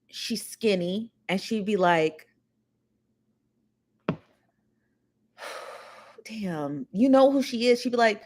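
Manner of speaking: ordinary talk, nothing marked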